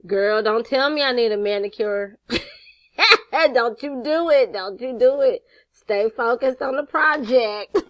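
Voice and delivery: mock scolding. Scolding herself over her nails, laughing.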